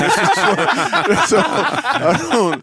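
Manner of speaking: slow words through real laughter; giggling while trying to talk; laughing. Real, not acted.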